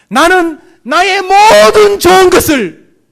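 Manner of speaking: loud, high, drawn-out imitation of a tearful tribute; slow, swelling cry of gratitude, imitated; retold tearful declaration. Preacher retelling an athlete's tearful broadcast about his mother, loud impassioned imitation. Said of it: "울면서 방송했습니다".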